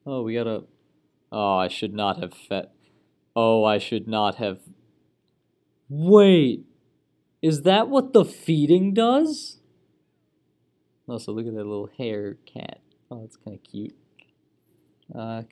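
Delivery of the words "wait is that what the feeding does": surprised. Real, not acted. Realising the feeding backfired.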